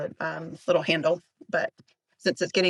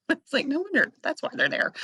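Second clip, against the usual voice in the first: amazed realization; 8 semitones higher than usual. Retold or imitated. Retelling a mind-blown realization.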